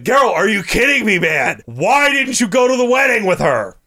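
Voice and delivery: high, animated mock disbelief; incredulous scolding. Ghost scolding Geralt over the wedding, incredulous. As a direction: berate a friend in exaggerated disbelief.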